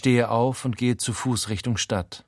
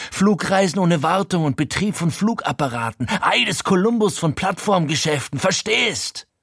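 much higher, straining, overexcited; voice cracking; 9 semitones higher, 3 dB louder than usual. A narrator's reading. Frankie ranting at his friend, voice cracking high. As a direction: let the voice go up and crack. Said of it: "Seine Stimme überschlägt sich".

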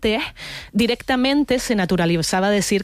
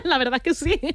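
high, laughing agreement; laugh, then agree through the laughter; real laughing; 10 semitones higher than usual. Real, not acted. Comedian amused by the host's teasing about easy questions, laughing.

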